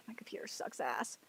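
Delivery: whispered grumble under the breath; muttered whisper. Real, not acted. Streamer grumbles to himself about his computer, whispered. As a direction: hush it, annoyed at the computer.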